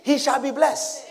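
ordinary delivery

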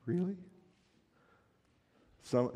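ordinary talk, nothing marked

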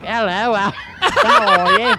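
mock British greeting, breaking up laughing; dumb British accent. Shown as a demonstration. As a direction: put on a silly British accent. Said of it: "my dumb British accent".

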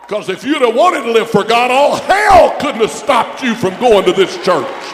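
very high, very loud pulpit shout; full preaching shout. Real, not acted. Preacher rebuking backsliders, shouting at full force. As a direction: roar it at the church.

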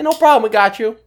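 high hostess voice. Retold or imitated. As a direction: squeak it out like a cheery hostess. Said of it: "She's like".

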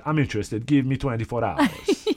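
hammed-up Italian accent, savouring each word; crazy Italian accent. Retelling the client agreeing to the deal, laughing mock Italian accent. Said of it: "she's got this crazy Italian accent".

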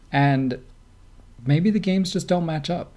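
ordinary delivery

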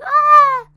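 loud, high acted scream; one long, high-pitched scream. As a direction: re-enact a staged shriek of shock. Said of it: "I scream, like".

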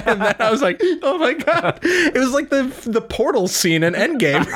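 high and laughing, bubbling over; giddy excitement. Real, not acted. Fan gushing to co-host about the reveal, laughing excitedly. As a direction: gush through giggles.

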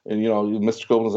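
ordinary delivery

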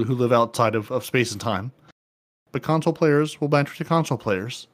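ordinary talk

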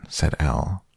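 ordinary delivery

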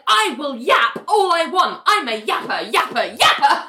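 taunting, sing-out retort breaking towards a laugh; mock defiance. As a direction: imitate a petulant squabbler digging in. Acted squabble, defiant comeback.

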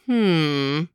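long, falling thoughtful hmm; drawn-out hum of wondering; pondering hum. Acted. Host wondering what was inside the box, pondering hum. Pondering the mystery for young listeners.

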